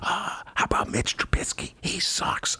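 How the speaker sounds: confidential sports-gossip whisper. Acted. Adviser gossips about football to the mayor, whispering. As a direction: whisper it into someone's ear. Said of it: "whispers in the Lori Lightfoot's ear".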